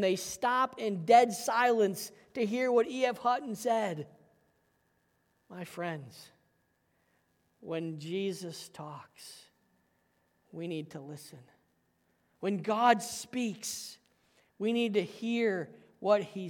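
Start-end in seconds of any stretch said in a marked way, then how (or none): 5.5-11.4 much softer, slow, low; hushed appeal. Real, not acted. Pastor urging the congregation softly to listen. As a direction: draw them in with a near hush. Quiet solemn appeal after story.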